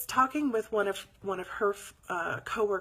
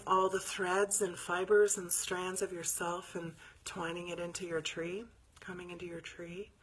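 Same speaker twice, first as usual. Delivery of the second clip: calm meditation guide; guided meditation. Acted. Guiding her live viewers into meditation, calmly. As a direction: ease the group into stillness.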